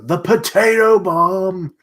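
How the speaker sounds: high, loud, drawn-out exclamation; excited shout. Real, not acted. Reader guessing what he's about to do, excited shout. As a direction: shout it out with glee, stretching it.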